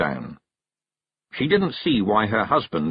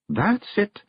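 high Scots wife, pleased; approving Kelda; 12 semitones higher than usual. Acted. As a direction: confirm his success. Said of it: "she said at last".

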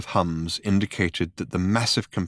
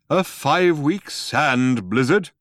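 slow, higher, louder disdain; haughty; 7 semitones higher, 6 dB louder than usual. A narrator's reading. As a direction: look down on the question. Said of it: "said Deep Thought haughtily".

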